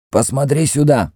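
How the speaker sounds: high-pitched commanding priest; Mefres the priest. Acted. High, insistent priest's voice. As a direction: priest orders the Greek to look into the crystal, high-pitched command.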